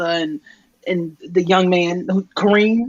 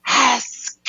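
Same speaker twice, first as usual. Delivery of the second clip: imitated snake's long hiss; drawn-out sibilant serpent noise; Parseltongue snake hiss; 5 semitones higher than usual. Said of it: "He's speaking Parseltongue".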